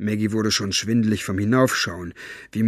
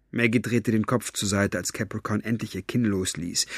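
usual speaking voice

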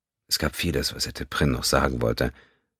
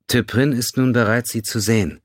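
Vulcan woman; 9 semitones higher, 4 dB louder than usual. A narrator's reading. Vulcan woman announces Teprin ready.

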